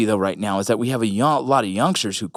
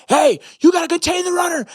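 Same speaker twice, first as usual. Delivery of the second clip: high-pitched mock sideline yell; imitated scream; 3 dB louder than usual. Dad yelling at his linebacker son, mock scream. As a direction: imitate screaming an order at a player. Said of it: "go to him and scream like".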